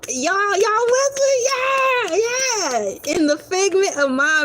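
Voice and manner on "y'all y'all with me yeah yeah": high shouted call to the crowd; rallying cheer. Pretend. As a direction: fire up the imaginary mob.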